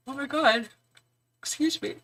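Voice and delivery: words pulled through a yawn. Real, not acted. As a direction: yawn while talking. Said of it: "that yawn came out of nowhere".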